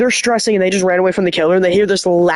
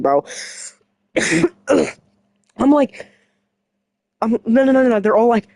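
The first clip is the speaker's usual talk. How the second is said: ordinary talk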